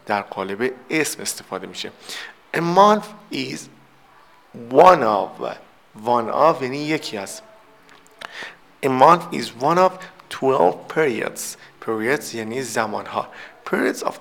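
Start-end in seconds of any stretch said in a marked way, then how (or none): 2.5-6.6 very slow reading. Shown as a demonstration. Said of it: "teacher reads example slowly". 8.8-11.4 very slow dictation. Shown as a demonstration. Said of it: "teacher reads example slowly".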